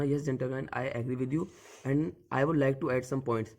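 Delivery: very slow, measured, polite English; polite debate reply. Shown as a demonstration. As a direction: spell out each word calmly, very slowly. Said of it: "तो आप बोलना".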